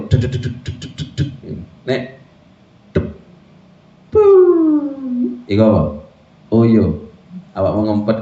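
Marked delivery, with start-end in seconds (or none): 0.1-5.4 pulsing sound effect. Shown as a demonstration. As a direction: imitate a throbbing beat that bursts. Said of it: "Mesti kayak gitu".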